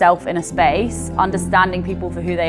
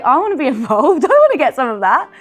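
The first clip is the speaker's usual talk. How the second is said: higher, louder burst of keen excitement; eager exclamation; 8 semitones higher, 7 dB louder, faster than usual. Retold or imitated. Manager retelling her urge to get involved, excited. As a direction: blurt it out eagerly, half laughing. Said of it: "I was like".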